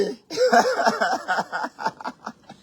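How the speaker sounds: high cackle, trailing away; high, helpless peal of laughter; long real laughing fit. Real, not acted. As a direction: laugh on until out of breath. Laughs on at the comments.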